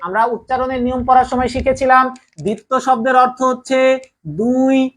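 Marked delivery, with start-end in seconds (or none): 4.4-4.9 stretched-out answer; slow emphasis. Shown as a demonstration. Teacher stressing the meaning of dvitva, slowly. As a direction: draw out the answer so it sticks. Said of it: "কয়বার?".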